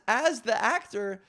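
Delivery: ordinary talk, nothing marked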